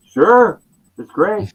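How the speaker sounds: high, loud eager acceptance; eager yes. Retold or imitated. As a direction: jump at the invitation. Eager acceptance of idol's invitation.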